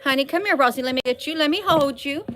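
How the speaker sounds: high, playful pet-coaxing voice; teasing singsong invitation to cuddle. Real, not acted.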